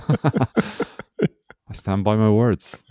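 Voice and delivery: laughed out very slowly; laughing. Real, not acted. Laughing as he defends his new friends to his co-host. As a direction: laugh your way through the words, standing firm.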